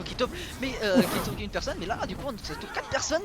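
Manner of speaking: rising excited breakdown. Real, not acted. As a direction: hype up the combo replay. Replay analysis of big combo.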